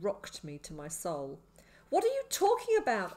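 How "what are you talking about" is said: high, loud question; demanding. A narrator's reading. Boy confronts the madman over his words, demanding. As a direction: demand an answer at near-shout. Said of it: "I demanded".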